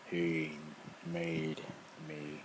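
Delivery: beast man. Acted. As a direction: let the brute speak slowly and dimly.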